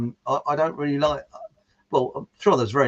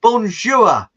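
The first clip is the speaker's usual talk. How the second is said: loud Cockney bonjour with a high start; Cockney French; 5 semitones higher, 9 dB louder, much slower than usual. Retold or imitated. Teacher greeting the class in Cockney French.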